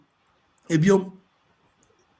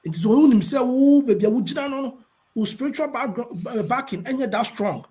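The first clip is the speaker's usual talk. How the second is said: higher, slowed emphatic point; fired up; 6 semitones higher, 4 dB louder than usual. Real, not acted. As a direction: drive the point home like a preacher. Preaching point, raised voice.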